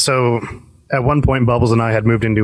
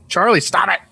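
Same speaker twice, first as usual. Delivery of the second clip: higher-pitched scolding call; retold yell; 9 semitones higher than usual. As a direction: imitate yelling at a pesky roommate. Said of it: "Every time he would yell".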